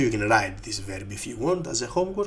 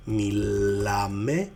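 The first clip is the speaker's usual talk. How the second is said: drawn-out, careful μιλάμε; slow teaching model; much slower than usual. Shown as a demonstration. Teacher conjugating "we speak" for learners, slowly. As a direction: stretch out each syllable of μιλάμε.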